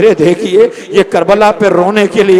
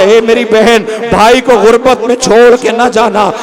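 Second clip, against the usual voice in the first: impassioned lament; 7 semitones higher, 4 dB louder than usual. Acted. Preacher voicing Sajjad and Sakina, rising lament. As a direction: push the brother's plea upward.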